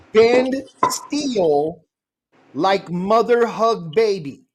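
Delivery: slow, solemn pidgin instruction; slow dramatic reading. Shown as a demonstration. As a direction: draw it out like a proverb.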